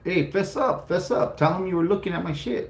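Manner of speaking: put-on a boy pushing his classmate to admit it; pleading kid.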